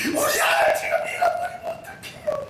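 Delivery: imitated mother's loud weeping cry; wailing mother. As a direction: sob it out to the officers. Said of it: "막 펑펑 울어가면서".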